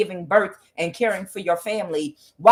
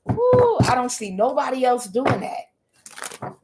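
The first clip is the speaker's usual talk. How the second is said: amazed exclamation; 5 semitones higher, slower than usual. Retold or imitated. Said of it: "I was like".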